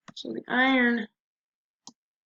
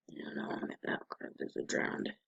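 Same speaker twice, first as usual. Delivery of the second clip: ordinary talk, nothing marked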